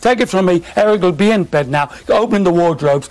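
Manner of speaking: playful retold TV bluster; hyped-up telly bravado. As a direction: ham up the TV banter like a showman. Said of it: "saying on the telly the night before".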